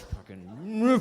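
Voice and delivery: drawn-out, strangled mock rage; hammed-up seething roar; furious outburst. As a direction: act out speechless road rage, slowly.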